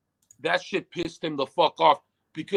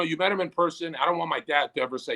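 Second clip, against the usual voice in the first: ordinary talk, nothing marked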